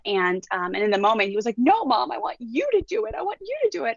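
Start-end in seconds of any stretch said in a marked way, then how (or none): 1.6-4.0 put-on high, whiny kid begging; a son refusing, with a rising whine; whiny pleading. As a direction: plead with mom like a reluctant boy. Son pleading with his mom.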